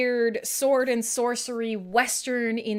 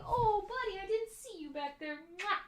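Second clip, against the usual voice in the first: gentle apologetic coo; soothing the dog; 9 semitones higher, 11 dB quieter than usual. Real, not acted. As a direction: coo softly to a dog you bumped. Tender talk to his dog.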